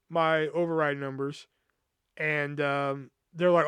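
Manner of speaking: ordinary delivery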